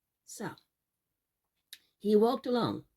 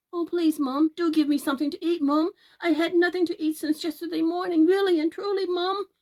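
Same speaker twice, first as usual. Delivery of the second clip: high, fast and louder begging; pleading boy; 8 semitones higher, 6 dB louder, faster than usual. Acted. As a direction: beg her like a starving boy. Jack begging for food.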